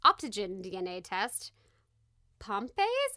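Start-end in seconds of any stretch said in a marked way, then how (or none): 2.4-3.1 Pompey's, tried as POM-payz with a questioning rise; testing how to say Pompey's; unsure pronunciation. Shown as a demonstration. Reading a breed name aloud, unsure how to say it. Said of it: "I don't know if that's Pompe or Pompey's or Pomps".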